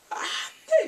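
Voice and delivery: high, loud mock wail; dismayed wail. Viewer wails over the breakup, high dismay. Wailing at the plot twist.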